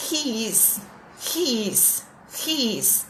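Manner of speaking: He is with a Brazilian accent, soft; Portuguese-sounding He is, I-S spelled out; Brazilian-accented English. Shown as a demonstration. Teacher demonstrates the Brazilian-accented He is to the class. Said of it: "vou fazer a pronúncia aportuguesada".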